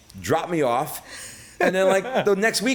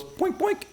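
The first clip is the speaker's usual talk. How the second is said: boink boink in a much higher cartoon squeak; mock boink noise; 4 dB quieter than usual. Telling a friend how she popped up naked, a high cartoon boink. Mimics her springing up naked.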